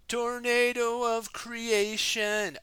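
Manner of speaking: high, slow drawn-out battle cry; spell shout. Acted. Jay calling out the spell to the team, slow battle shout. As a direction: call out the ninja spell to the heavens.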